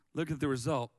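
normal talking voice

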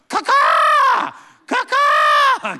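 high shrieking caw-caw bird call; loud high bird squawk; hawk call. Retold or imitated. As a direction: screech it like a hawk. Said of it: "given out by the Love Hawk".

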